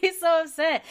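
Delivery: normal talking voice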